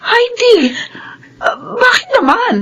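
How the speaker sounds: high, crying call of her name; tearful plea. Acted. Housemaid cries out as the girl turns on her. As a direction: cry out her name in distress. Mother confronted by angry daughter.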